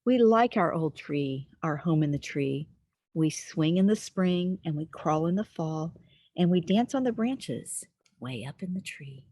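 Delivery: lilting, sing-song read-aloud; reading to children. Acted. Storyteller reading a rhyming book to little listeners, sing-song. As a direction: read it like a bedtime rhyme.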